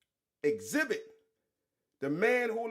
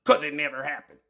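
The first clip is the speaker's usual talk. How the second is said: mocking the lawyer; 7 semitones lower, 5 dB louder than usual. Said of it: "Look at this stupid ass lawyer".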